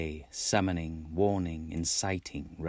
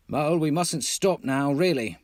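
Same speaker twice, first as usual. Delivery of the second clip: higher, louder call over the shoulder; calling back; 7 semitones higher, 4 dB louder than usual. A narrator's reading. The Rat calls back to Mole while hurrying on. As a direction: call back without stopping. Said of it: "he called back".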